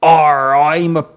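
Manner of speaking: loud pirate demo with a big "Arr". Shown as a demonstration. Director showing the actor how it goes, loud pirate voice. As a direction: show him a hearty, loud pirate. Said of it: "do it with a pirate voice".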